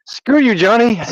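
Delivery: high-pitched joking comeback, laugh ready behind it; mock indignation. Retelling his comeback to Johnny's teasing, mock indignant. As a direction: throw it back high, in playful protest.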